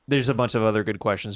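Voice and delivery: ordinary talk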